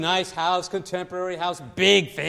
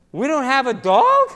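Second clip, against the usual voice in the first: put-on shocked kid; 5 semitones higher, 9 dB louder than usual. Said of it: "He says".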